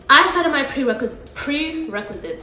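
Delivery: talking around a retainer; slightly lisped retainer speech; wearing a retainer. Real, not acted. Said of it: "sorry guys, I have a retainer".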